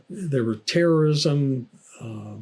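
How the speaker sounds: ordinary talk, nothing marked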